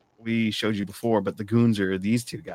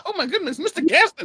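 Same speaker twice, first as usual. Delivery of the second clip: very high mock-fangirl voice; hammed-up breathless admirer; swooning woman; 4 dB louder than usual. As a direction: squeal it like a smitten Southern belle. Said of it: "I got women swooning".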